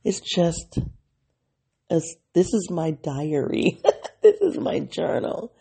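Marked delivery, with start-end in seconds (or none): 3.8-5.5 laughing through the line, voice lifted. Real, not acted. Vlogger laughing that the show is her journal, laughing. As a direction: let a real laugh break over the words. Laughs at own diary remark.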